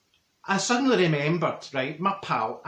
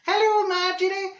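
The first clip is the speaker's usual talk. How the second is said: put-on squeaky high kids' greeting, louder; children's chorus; 5 dB louder than usual. Mimicking the schoolkids at the fence, high and loud. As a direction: pitch way up like a crowd of weans. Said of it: "they go".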